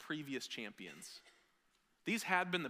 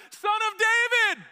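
much higher and louder, slow, far-carrying cheer; children's cheer; 16 dB louder, slower than usual. Retold or imitated. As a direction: shout it like a crowd of kids. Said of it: "They're like".

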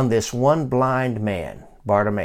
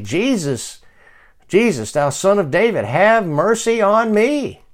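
higher-pitched plea, last words drawn out; pleading call that climbs in pitch; crying out; 7 semitones higher than usual. Acted. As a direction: cry out for mercy, building as you go. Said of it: "He began to cry out".

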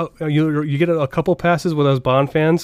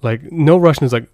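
usual speaking voice

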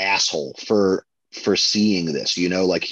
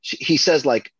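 normal talking voice

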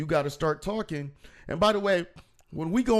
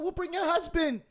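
exasperated retort. Retold or imitated. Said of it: "I said".

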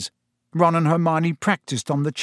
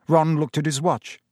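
usual speaking voice

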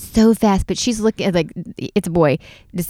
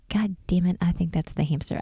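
softer whispered curse; whispering so the hamster stays put; hushed whisper; 7 dB quieter than usual. Retold or imitated. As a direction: breathe it so it won't run. Spotting hamster in curtain.

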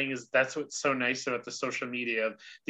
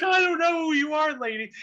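tearful disbelief. Retold or imitated. Telling her own reaction to Tabitha, high and teary. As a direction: squeak it out, overwhelmed. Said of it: "I was like".

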